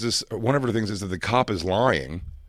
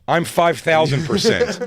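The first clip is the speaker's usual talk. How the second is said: slow, louder, higher insistence; emphatic; 6 semitones higher, 5 dB louder, slower than usual. Retold or imitated. Wife assuring undercover cop, slow and emphatic. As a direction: drag out the certainty. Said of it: "She goes".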